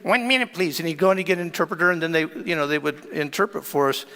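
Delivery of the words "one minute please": high comic squeak; squeaked, halting English; squeaky voice. Retold or imitated. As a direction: imitate a funny squeaky-voiced cellmate. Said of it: "He had this squeaky voice".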